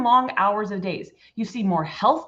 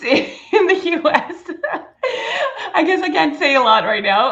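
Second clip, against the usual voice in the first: real laughter, high and louder; laughing; 6 semitones higher, 6 dB louder than usual. Real, not acted. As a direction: giggle while dodging the question. Laughs about hiding her location.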